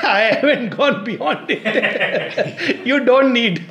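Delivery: high voice breaking into laughs; real laughter. Real, not acted. Teacher amused by a girl's answer on love, laughing. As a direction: talk helplessly through laughter. Laughing while retelling the exchange.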